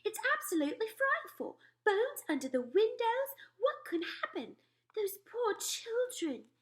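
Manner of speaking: a queen still upset by a nightmare; queen's voice. Acted. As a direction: tell the dream as a rattled queen. Queen horrified.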